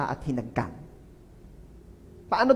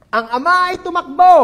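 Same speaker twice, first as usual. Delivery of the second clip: emphatic; 7 semitones higher, 10 dB louder than usual. Shown as a demonstration. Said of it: "Pero nagpapatawad ay ito".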